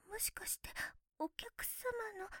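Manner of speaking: breathless unvoiced whisper. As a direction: hush it, barely breathing. Sudden hushed aside in scene. (ささやき声)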